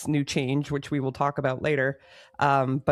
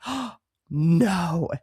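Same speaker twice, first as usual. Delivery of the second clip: gasp, then a dismayed groan of realization; gasped, drawn-out dismay; retold panic. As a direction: re-enact the sinking moment of alarm. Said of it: "And I am freaking out".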